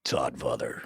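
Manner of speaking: deep, soft movie trailer voice; voiceover voice. Acted. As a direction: drop low like a trailer narrator. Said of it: "you should do voiceover work".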